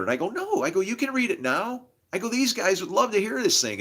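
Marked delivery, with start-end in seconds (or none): 0.3-0.6 high-pitched "no" of protest; retold protest. 0.8-3.8 higher-pitched, insistent coaxing; retold eager urging.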